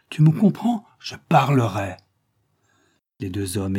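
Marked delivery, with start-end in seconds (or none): 0.1-2.0 sudden high, loud demand, then firm; emphatic threat. Acted. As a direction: drive the warning home loudly. Old man threatening to testify.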